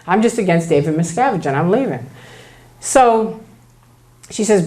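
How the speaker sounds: normal talking voice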